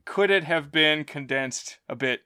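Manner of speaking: ordinary delivery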